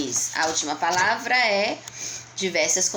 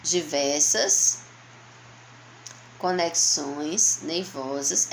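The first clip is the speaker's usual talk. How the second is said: word by word; 3 semitones lower, much slower than usual. Shown as a demonstration. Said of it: "Separe as palavras com uma barra".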